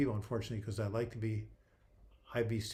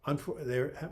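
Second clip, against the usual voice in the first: ordinary delivery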